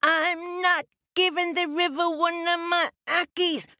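put-on high stubborn mean-girl voice; defiant, mean little girl; mean girl shout. A narrator's reading.

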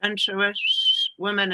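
"sensuous" with long hissing s and sh sounds; drawn-out sibilants. Shown as a demonstration. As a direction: stretch every hiss in "sensuous". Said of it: "stuck talking like this".

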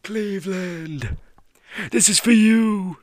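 high, slow, loud crying-out imitation; emotional cry out. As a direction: belt it out like a tearful champion. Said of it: "he was crying ... cried out".

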